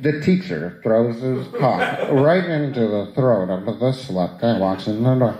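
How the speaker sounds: described video narrator. Retold or imitated. Comedian mimicking the porn audio description, lightly voiced deadpan narration. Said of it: "you can see it's just like".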